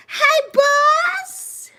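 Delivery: very high, squeaky hello; excited greeting. Real, not acted. Streamer greets boss in chat, excited high squeak.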